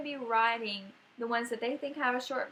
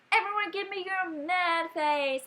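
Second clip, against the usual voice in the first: playful teacher voice; 6 semitones higher, 3 dB louder, slower than usual. Pretend. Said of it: "I'm saying".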